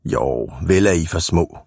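low grumble of a bully; growling. A narrator's reading. Ivan tells the small boys they are too small, growling. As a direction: grumble it low, putting them down. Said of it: "brummede Ivan".

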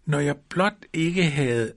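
high, drawn-out exclamation mid-fall; exclaiming. A narrator's reading. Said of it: "udbrød han". (langsomt udbrud med hævet, lys stemme)

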